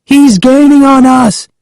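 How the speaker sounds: high, loud warning shout; alarmed call. A narrator's reading. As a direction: call out in panic to someone ahead. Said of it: "I called to Sari".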